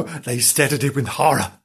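ordinary delivery

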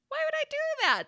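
high, loud squawk of disbelief; mock outrage. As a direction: shriek it in playful indignation. Mock outrage at depriving her soul.

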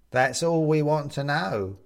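slow, coaxing skeleton. A narrator's reading.

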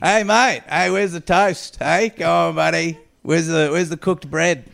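half-hearted impression of a mate asking for toast; mock Steve voice. Voicing Steve as the spread.

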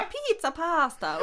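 slow, drawn-out cartoon Italian with a laugh; mock Italian. Girl teasing the Italian guy at the party, mock Italian accent. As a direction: tease in a silly fake Italian voice. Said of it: "Jag försökte vara så rasistisk".

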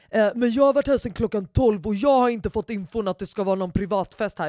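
party crasher whining about missing info; mocking the guy. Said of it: "Och är så här".

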